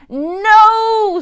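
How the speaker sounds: high, loud desperate no; scream. A narrator's reading. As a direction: scream it in panic. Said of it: "screamed Joe".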